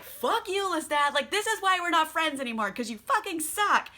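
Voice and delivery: high-pitched, peeved telling-off; mock upset. Louis blaming Lestat for the death, mock upset. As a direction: end the friendship in exaggerated hurt. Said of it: "he's all upset".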